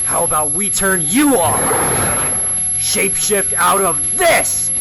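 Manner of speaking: angry, rising to a punched last word; combative anger. Acted. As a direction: hurl it as a taunt mid-attack. Hero attacks the creature.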